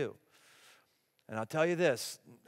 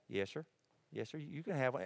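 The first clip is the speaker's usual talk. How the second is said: put-on Alabama good old boy; 2 semitones lower, 9 dB quieter than usual. Alabama welder answering questions by the fire, polite Southern accent. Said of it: "he talked like this".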